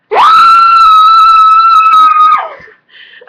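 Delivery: a long high squealing laugh; shrieking laugh. Real, not acted. Fan squealing at the new book, shrieking laugh.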